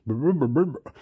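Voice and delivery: trilled lip-buzz "brr"; imitated babble. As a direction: burble like a little motor. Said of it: "he's saying".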